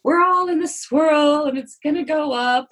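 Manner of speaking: mock upbeat pep. Mocking her planned class theme, sing-song cheer. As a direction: sell the sunny theme with a wink, slowed and lifted.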